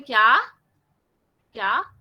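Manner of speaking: क्या rising like a puzzled question; क्या said like an Urdu question. Shown as a demonstration. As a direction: ask क्या with a climbing pitch. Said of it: "जैसे हम उर्दू में बोलते हैं".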